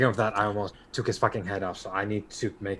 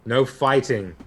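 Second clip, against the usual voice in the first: higher, loud call to break up a fight; shout; 4 semitones higher, 8 dB louder than usual. Acted. Player character stopping a street fight, loud commanding shout. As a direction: yell across the street to stop him. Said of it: "I'm gonna shout at this guy".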